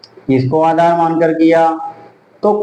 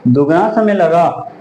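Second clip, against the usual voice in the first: normal talking voice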